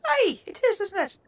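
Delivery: high-pitched Irish gnome voice. Acted. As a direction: answer the fellow gnome in a high Irish lilt. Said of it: "I don't know why I'm Irish".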